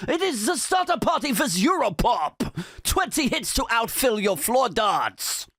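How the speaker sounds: high, hammed-up Lithuanian accent; bad Lithuanian accent. Comic mocks a Lithuanian pop compilation advert, bad Lithuanian accent. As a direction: do a terrible Lithuanian pop-album announcer, pitched high. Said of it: "a terrible attempt at a Lithuanian accent".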